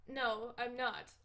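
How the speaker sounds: normal talking voice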